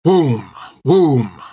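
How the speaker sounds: a short booming musket shot; musket shot noise. A narrator's reading. Narrator imitating the musket shots, booming sound. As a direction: voice the gunfire.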